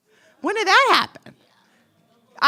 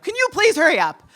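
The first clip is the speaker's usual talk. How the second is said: impatient; 7 semitones higher, 8 dB louder than usual. Retold or imitated. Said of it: "and I'm like".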